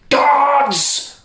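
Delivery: king calling the guards. Acted. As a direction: summon them like a king. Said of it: "called the king".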